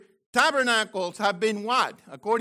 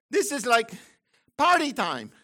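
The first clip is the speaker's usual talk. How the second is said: normal talking voice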